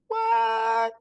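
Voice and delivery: high, long drawn-out disbelieving what; astonished what. Retold or imitated. Author reacting to her award nomination, astonished. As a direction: stretch the word high in playful shock.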